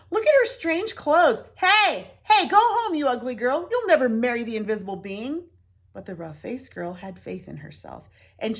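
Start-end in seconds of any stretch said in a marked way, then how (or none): 0.1-5.4 high, loud mocking jeers; loud taunting. A narrator's reading. Villagers jeering the rough-faced girl, loud high taunts. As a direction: shout the taunts high and cruel.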